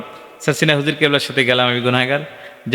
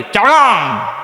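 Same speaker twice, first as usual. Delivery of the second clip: higher, Chittagong dialect chargang for Chittagong; Chittagong accent; 4 semitones higher, slower than usual. Retold or imitated. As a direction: repeat it in the boy's Chittagong accent. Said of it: "আর একবার ক". (চট্টগ্রামের টানে আবার "চারগাং")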